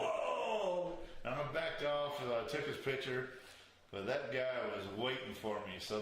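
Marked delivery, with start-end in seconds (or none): 0.0-1.0 high, drawn-out mock alarm; high-pitched retold startle; startled whoa. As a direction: reenact jumping back with a long whoa. Retells recoiling from copperhead.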